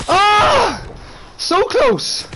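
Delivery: high, loud cry of frustration at a near miss; excited shout. Real, not acted. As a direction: yell it out, gutted.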